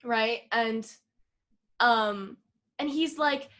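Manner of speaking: ordinary delivery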